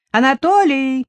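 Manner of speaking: громкий высокий окрик в подсобку; крикнуть через стену. Acted. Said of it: "крикнула она в подсобку". (продавщица кричит мужу в подсобку)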